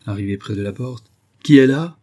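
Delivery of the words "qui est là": a loud, unfriendly shout through the door; gruff loud call. Acted. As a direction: bark it out, high and loud, anything but friendly. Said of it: "d'une voix accentuée qui n'avait rien d'absolument aimable". (crier sèchement, fort et haut, à travers la porte)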